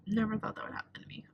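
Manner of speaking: breathing the words out in disbelief; whisper. Real, not acted. Student shaken after the lockdown, whispering.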